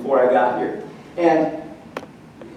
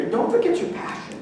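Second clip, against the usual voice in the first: high soft plea; 13 semitones higher, 6 dB quieter than usual. Acted.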